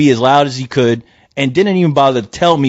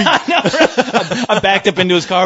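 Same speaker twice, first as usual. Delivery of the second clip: ordinary talk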